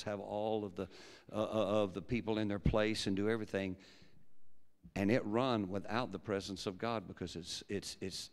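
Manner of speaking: usual speaking voice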